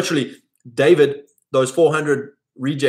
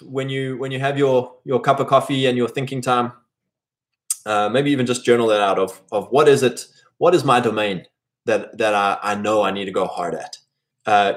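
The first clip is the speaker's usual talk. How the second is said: normal talking voice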